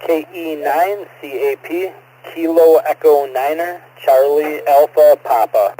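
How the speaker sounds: loud distorted radio voice; over a crackling radio link; crackly military radio. Real, not acted. Caller spells call sign over radio.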